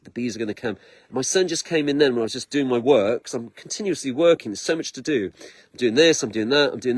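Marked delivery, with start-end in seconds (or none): 0.0-0.8 low, soft knowing murmur; hushed certainty. Retold or imitated. Hearing bees in the tree, murmuring the bees are coming. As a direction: say it softly, as a quiet promise. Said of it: "I'm like".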